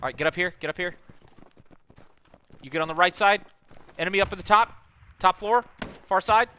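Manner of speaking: high loud combat callouts; cover me shout. Real, not acted. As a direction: yell directions to your squad.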